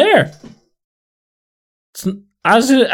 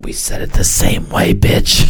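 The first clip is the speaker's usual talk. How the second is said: whispered indignation; 6 semitones lower than usual. Real, not acted.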